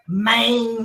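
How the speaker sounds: "Maine" stretched out through a laugh; playful second try at Memphis "Maine"; Memphis slang pronunciation. Shown as a demonstration. Guest pleased with the local catchword, "Maine" drawn out laughingly. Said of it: "I don't think I've mastered it yet".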